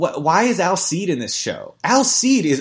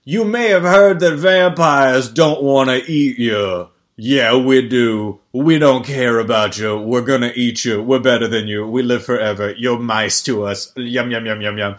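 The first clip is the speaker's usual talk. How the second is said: menacing vampire boast; 3 semitones lower, 4 dB louder than usual. Retold or imitated. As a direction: gloat like a smug vampire toying with mice. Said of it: "and he's like".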